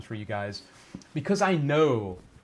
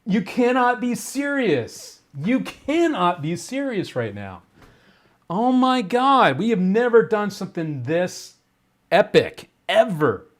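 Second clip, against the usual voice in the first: blown away, stretch out the key words; stunned excitement; 8 semitones higher, 5 dB louder than usual. Real, not acted. Streamer reacting live to a huge surprise donation, stunned and excited.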